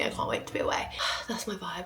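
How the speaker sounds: whisper. Real, not acted. Dreamy aside about holidays.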